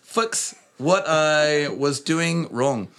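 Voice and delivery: put-on New Zealand accent. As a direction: keep the Kiwi accent going. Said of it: "don't at me about the, uh, accent".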